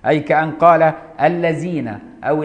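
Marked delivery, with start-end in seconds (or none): 1.2-2.0 الذين said with a z sound for ذ; tongue behind teeth, الذين as 'alleezina'; z instead of dh. Shown as a demonstration. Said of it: "بالزاي أو الدال مهملة".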